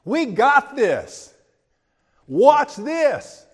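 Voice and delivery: loud, cocky boast pitched high; brash, triumphant bragging; pumped up. Retold or imitated. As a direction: crow over the ark with swagger. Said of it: "they were pumped".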